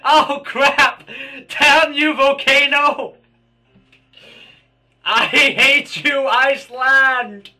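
loud, high, slow mock rant; mock outrage. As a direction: shout curses at a volcano, hamming it up. Ranting at volcano in game.

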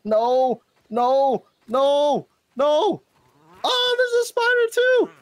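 high loud rising screams; screaming at a spider. Real, not acted. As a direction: scream in terror. Spider attack.

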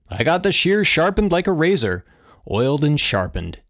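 high, animated father's boast; cheerful boast. A narrator's reading. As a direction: brag happily about the job.

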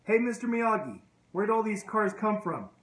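high, loud call across the yard; retold yell. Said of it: "he yells at Mister Miyagi".

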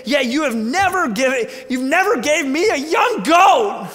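bitter yelling resentment; angry older brother. Acted. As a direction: rail at your father like the wronged son. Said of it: "There, there's some aggression here".

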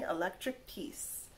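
ordinary delivery

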